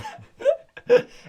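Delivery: squealing burst of laughter; high squealing laugh. Real, not acted. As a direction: shriek with laughter. Laughs at the Italian joke.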